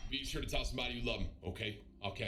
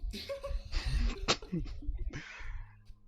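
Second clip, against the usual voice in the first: real high wheezy laugh; 10 semitones higher than usual. Real, not acted. Streamer reacting to his own story, real laughter. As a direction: crack up mid-story.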